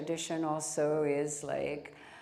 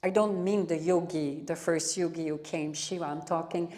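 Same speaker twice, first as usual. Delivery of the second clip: normal talking voice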